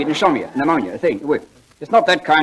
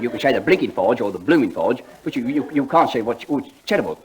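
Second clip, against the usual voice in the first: normal talking voice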